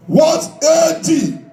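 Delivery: ordinary talk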